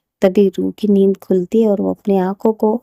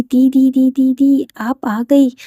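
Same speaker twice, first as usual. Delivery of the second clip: excited, raised calls of didi, stretched at the end; loudly calling out; 5 semitones higher, 3 dB louder, slower than usual. A narrator's reading. Little sister loudly greets her returning didi, excited. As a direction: call out to your sister, thrilled she's home. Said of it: "इतने ज़्यादा loudly".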